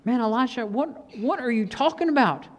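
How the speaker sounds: a touch of disbelief, stumbling over the question; mild incredulity. Acted. Servant questions Elisha's calm answer, mildly incredulous.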